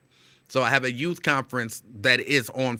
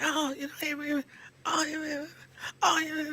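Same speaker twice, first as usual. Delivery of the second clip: high, soft begging; mock pleading clerk; 13 semitones higher, 6 dB quieter than usual. Imitating the clerk begging him to wait, high and soft.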